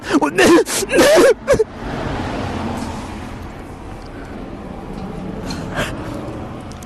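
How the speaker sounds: high, breathless laughing fit; real helpless laughter; long wheezing laugh. Real, not acted. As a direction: laugh till no sound comes out.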